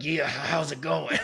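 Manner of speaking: slow, laughing mock greeting; mock chipper greeting. Host mocking himself before coffee, laughing. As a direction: drag it out like a tired host. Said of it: "sit here and be like".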